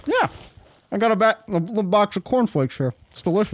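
words round a bite of food; mouth full. Real, not acted. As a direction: still chewing dry cereal. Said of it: "That is disgusting".

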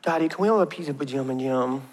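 put-on soft little-boy voice begging for pizza night; sweet toddler plea. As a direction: ask sweetly, lip out. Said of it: "pull his head back and be like".

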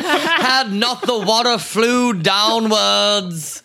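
slow, high, mock-grand stage delivery laughing; Shakespearean recitation. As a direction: ham it up like a Shakespearean actor. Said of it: "Is that your Shakespearean recap?".

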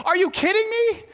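high, disbelieving are you kidding me; incredulous at the nerve; mock-exasperated outburst. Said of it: "I'm like".

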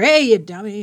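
a loud put-down barked at mom; mock yell. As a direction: yell it as the thing not to say. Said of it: "yell at her".

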